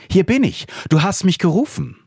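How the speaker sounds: put-on young boy. Young Samuel answers the call, eager high boy's voice. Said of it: "lief schnell zu Eli".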